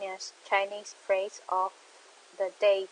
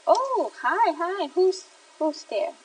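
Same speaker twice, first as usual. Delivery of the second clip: much higher and louder, bursting with surprise; excited greeting; 9 semitones higher, 5 dB louder than usual. Real, not acted. Host surprised that someone joined the livestream, excited high greeting. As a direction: squeal hello to a newcomer.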